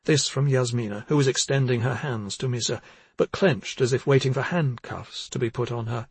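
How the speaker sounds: usual speaking voice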